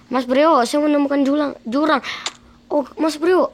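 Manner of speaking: high, loud alarmed call; excited shout. Acted. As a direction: yell out in alarm. Finds a ravine, calls friend.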